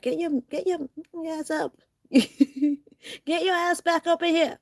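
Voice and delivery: high-pitched mock American swearing, breaking into laughter; giggly, high put-on American voice; American comedy-movie impression. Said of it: "their voice still sounds calm".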